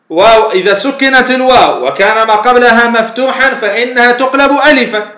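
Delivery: ordinary delivery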